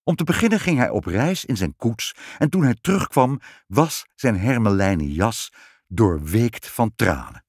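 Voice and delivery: plain reading voice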